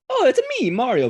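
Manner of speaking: high, cartoonish Italian plumber squeal; Mario voice. Podcast host mocking an over-the-top Mario voice, high and hammy. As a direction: jump way up into a hammed-up Mario.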